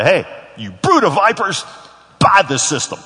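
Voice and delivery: a salesman's friendly opener to a customer, hammed up; mock sales pitch. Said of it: "they didn't teach me ... say".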